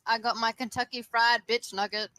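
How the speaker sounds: ordinary talk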